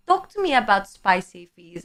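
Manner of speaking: ordinary talk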